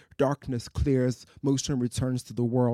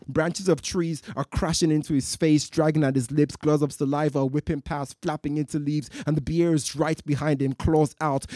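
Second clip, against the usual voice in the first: normal talking voice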